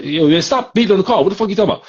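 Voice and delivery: high, loud, fast protest; angry yell. Retold or imitated. V inside the limo yelling at Nelson to stop, angry. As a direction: yell at him to quit hitting the car.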